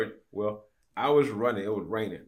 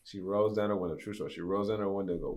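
normal talking voice

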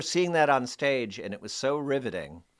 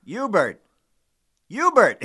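each Hubert higher and louder; building Hubert calls; 6 semitones higher, 9 dB louder, slower than usual. Retold or imitated. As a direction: call his name rising to a cry. He calls him Hubert three times.

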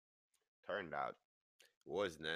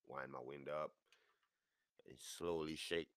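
ordinary talk, nothing marked

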